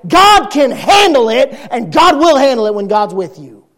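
loud, high, emphatic sermon delivery; full-force preaching. Real, not acted. Preacher tells congregation God will handle it, forceful and impassioned.

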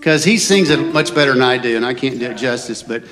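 normal talking voice

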